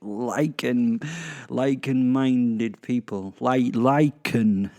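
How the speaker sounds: lichen drawn out slowly; stress on lichen. Acted. Host making sure listeners get the pun, lichen stressed. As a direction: hammer the pun on lichen, stretching it.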